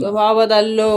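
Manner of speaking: low, dropped-pitch parroting; mocking echo. Wife parrots husband's plea to change her nature, mocking echo.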